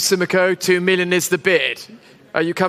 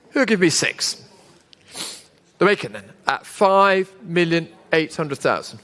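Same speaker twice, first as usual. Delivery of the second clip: auctioneer; 3 semitones lower than usual. Real, not acted. Live auction bid calling.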